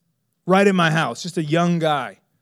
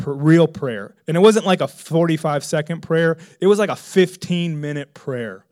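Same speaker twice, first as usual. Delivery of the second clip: ordinary talk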